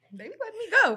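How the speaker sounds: high giggly tag-on; laughing high. Real, not acted. Friend laughs about police letting her go, high and giggly. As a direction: squeak it out through laughter.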